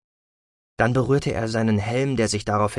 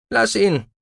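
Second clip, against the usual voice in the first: amused; 10 semitones higher, 4 dB louder than usual. A narrator's reading. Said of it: "Die Stimme klang amüsiert".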